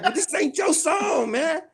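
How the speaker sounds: high, drawn-out mock indignation; pissed off. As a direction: imitate someone fed up and objecting. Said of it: "he was pissed".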